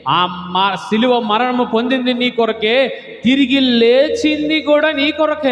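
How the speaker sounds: Jesus pleading it's all for you, swelling up; voicing Jesus. Pastor voicing Jesus' words to the congregation, building fervour. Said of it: "ఆయన అంటున్నాడు".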